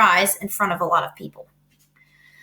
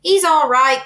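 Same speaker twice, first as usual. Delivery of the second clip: louder, higher anxious cry; 5 semitones higher, 7 dB louder, slower than usual. A narrator's reading. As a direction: shout reassurance fast. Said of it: "cried Mister Zuckerman".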